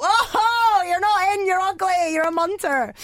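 high, loud, teasing rejection; mock taunt, pitched well up; mock jeering. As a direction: jeer it as a running joke, starting high and loud. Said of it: "it was a big running joke".

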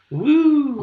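very high, sarcastic whoop; sarcastic whoop about Bournemouth; mock cheer.

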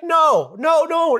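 high, urgent denials; desperate pleading. Retold or imitated. Begging the friend in the dream, desperate pleading. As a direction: refuse it, begging. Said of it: "I was pleading with him".

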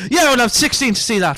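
put-on dad hurrying his kids to the film; eager. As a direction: push to go in now. Customer dad voiced.

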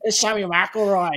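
tossing off a lightly put-on Irish accent on the phone; lightly voiced Irish manager introducing himself. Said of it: "it's like an Irishman".

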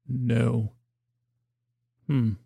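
usual speaking voice

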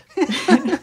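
short high nervous laugh. Real, not acted. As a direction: laugh it off uneasily. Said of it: "Yeah, nervous laugh".

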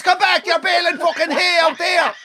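very high exasperated Clare holler; coach's shout. Retold or imitated. Coach to lad who has strayed onto the next pitch, exasperated shout. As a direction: imitate a coach yelling across two pitches. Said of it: "He's shouting to his young lad".

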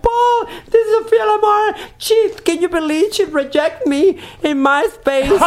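high, loud squeal, laughing through it; gushing fan. Retold or imitated. The fan tells Paul that Sophia rejected him, high excited squeal.

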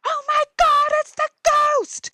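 high shriek of fright; terrified kids. Retold or imitated. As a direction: shriek as if you've seen a ghost. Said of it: "they're like".

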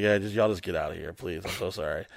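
low, soft retold plea; hushed apologetic plea. Cop quietly sending a cousin's relative away, soft plea.

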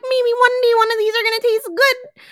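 high, wistful little voice; mock hopeful. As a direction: pipe up with naive hope. Acting out her own wistful hope.

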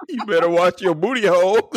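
laughing tease. Real, not acted.

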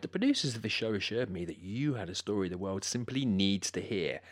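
normal talking voice

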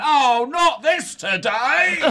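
weary cab driver. Retold or imitated. The cab driver sighing at his passenger, slow and fed up. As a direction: drag it out like a tired cabbie.

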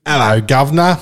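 terrible British accent. Shown as a demonstration. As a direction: do a bad British accent, loud. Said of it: "my British accent is terrible".

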